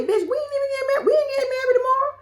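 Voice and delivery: high, fast exasperated imitation; mimicking Travis. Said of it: "Talking about".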